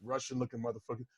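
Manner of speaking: usual speaking voice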